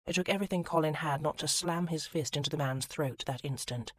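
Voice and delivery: ordinary delivery, nothing marked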